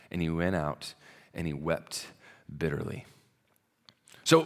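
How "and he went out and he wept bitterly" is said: hushed. A narrator's reading. Reading Peter's bitter weeping.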